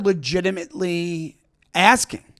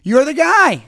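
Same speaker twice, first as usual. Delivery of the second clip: excited greeting; 7 semitones higher, 5 dB louder, slower than usual. Retold or imitated. Couple greeting him, retold.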